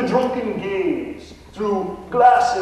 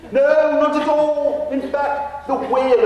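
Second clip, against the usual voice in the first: put-on a Texan faking a posh English accent, pitched higher; hammed-up English gentleman ghost; mock English accent; 8 semitones higher, 4 dB louder, slower than usual. Ghost Nigel answers the realtor in a fake English accent. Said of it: "a Texan trying to emulate an Englishman".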